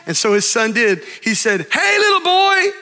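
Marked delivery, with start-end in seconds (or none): 1.7-2.7 yelling into a cave. Retold or imitated. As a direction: shout it out to hear the echo. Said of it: "I want you to yell into that cave".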